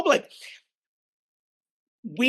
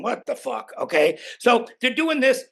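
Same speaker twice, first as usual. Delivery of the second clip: ordinary talk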